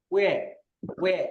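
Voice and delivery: ওয়ে said slowly, gliding উ into এ; drawn-out ওয়ে. Shown as a demonstration. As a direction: model ওয়ে clearly for students. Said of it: "এটা উচ্চারণ হবে এরকম ভাবে". (ওয়ে স্পষ্ট করে টেনে উচ্চারণ)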